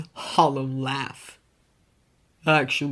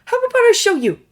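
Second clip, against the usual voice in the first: higher, louder eager offer; eager Clark; 10 dB louder, faster than usual. A narrator's reading. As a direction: pitch the idea brightly.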